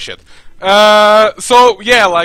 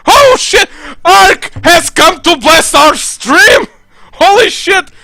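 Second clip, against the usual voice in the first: high, loud hype welcome; excited greeting; 11 semitones higher, 6 dB louder than usual. Real, not acted. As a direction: yell it like your best friend just walked in. Streamer greets a favorite viewer.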